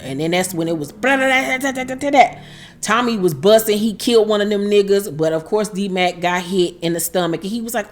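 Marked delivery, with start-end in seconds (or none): 1.2-2.3 high rapid-fire rattle; high-pitched stuttering gunshot noises; imitated gunfire. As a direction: mouth a burst of machine-gun fire. Imitating gunfire in the retold shootout.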